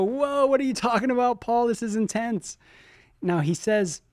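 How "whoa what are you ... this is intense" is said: high-pitched mock whoa of disbelief; mock amazement. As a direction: act stunned by what Paul says. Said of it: "where you just go".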